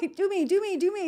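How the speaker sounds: high, giggly begging in quick repeats; eager pleading. Retold or imitated. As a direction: beg like an impatient kid, half laughing. Acting her eager plea, giggling.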